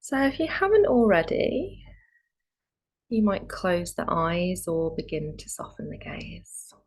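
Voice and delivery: calm meditation guide with long pauses; guided meditation. Real, not acted. Yoga teacher easing students into Yoga Nidra, calm guided meditation. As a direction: settle them gently into stillness, leaving space between phrases.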